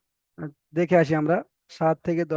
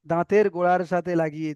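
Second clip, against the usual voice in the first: ordinary talk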